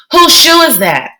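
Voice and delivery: put-on a worried young girl questioning her brother; child's voice, rising alarm; little sister Joey. Joey demands answer.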